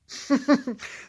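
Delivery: sudden high laugh; real high laugh; high burst of laughter. Real, not acted. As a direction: laugh out loud at the memory. Laughing at the memory.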